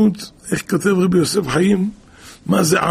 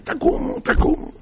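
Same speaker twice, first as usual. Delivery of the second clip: wake-up call. Retold or imitated. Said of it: "הוא בא כל יום צועק".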